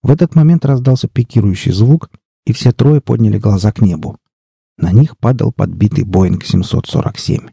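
ordinary delivery

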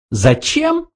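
very high, rising little question; questioning mouse. A narrator's reading. Mouse tests a question against the answer, high rising voice. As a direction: try the question out, squeaky high.

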